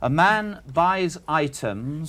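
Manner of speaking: slow, spaced-out delivery; slow dictation. Real, not acted. Questioner dictating a sum to be written, slowly. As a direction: read it out slowly for someone writing it down. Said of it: "I'll say it slowly".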